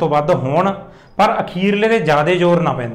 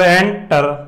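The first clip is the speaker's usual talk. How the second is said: "Enter" split, "ਐਂ" held and weighted; stress on first syllable; much slower than usual. Shown as a demonstration. Teacher sounding out the example, "enter" stressed on "ਐਂ". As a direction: lean on "ਐਂ", then a lighter "ਟਰ". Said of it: "ਉਹਦੇ 'ਤੇ ਜ਼ਿਆਦਾ ਜ਼ੋਰ ਪੈ ਰਿਹਾ".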